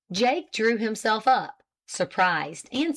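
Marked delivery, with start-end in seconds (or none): none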